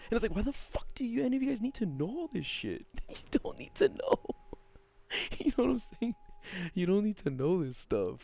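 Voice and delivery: high, incredulous rant breaking into laughter; exasperated laughter. Real, not acted. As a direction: crack up while complaining.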